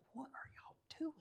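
high unvoiced whisper, stunned; professor's stunned whispered question; whispered disbelief. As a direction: breathe out what are you doing in shock. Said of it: "He had this look like".

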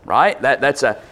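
normal talking voice

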